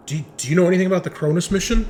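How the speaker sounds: urgent question. Acted. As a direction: blurt it out in a rush. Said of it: "And he was like".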